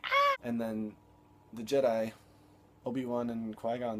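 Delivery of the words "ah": very high, loud mock shriek; scream. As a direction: shriek like a panicked cartoon alien. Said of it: "Jar Jar's like".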